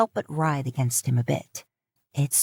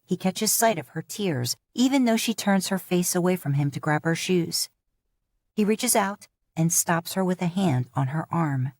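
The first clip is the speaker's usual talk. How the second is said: ordinary delivery, nothing marked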